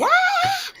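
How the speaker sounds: loud, very high belted "Ah!"; belted cry. Shown as a demonstration. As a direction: belt it out on a high sustained note. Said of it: "instead of always going".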